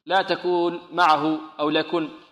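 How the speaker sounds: normal talking voice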